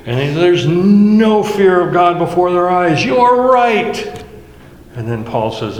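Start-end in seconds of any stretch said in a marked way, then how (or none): 0.3-4.2 high, loud parody of a cheering crowd; rally them as if everyone agrees heartily; mock crowd-rousing zeal. Preacher acts out a crowd cheering on the verse, mock zeal. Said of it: "kind of whip up the crowd".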